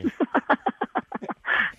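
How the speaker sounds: high, breathy caught-out laugh; giveaway laugh. Real, not acted. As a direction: laugh that gives you away. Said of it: "Questa risata, [name], ti ha tradita".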